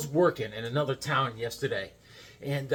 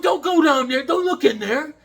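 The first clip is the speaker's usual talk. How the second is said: bossy warning; 11 semitones higher, 7 dB louder than usual. Retold or imitated. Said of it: "He goes".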